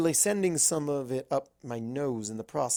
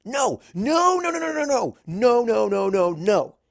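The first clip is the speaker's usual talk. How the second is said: much higher, loud string of panicked no's; frantic denial; 8 semitones higher, 8 dB louder than usual. Acted. Twilight frantically denies romance with Star Swirl, loud. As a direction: deny it in a flustered panic.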